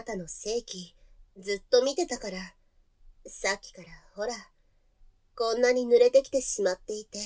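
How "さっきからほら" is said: soft unvoiced whisper. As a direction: murmur it close and secret. Confessing arousal softly. (恥ずかしそうにささやく)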